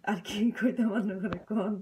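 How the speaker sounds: laughing. Real, not acted. Laughing playful question to viewers.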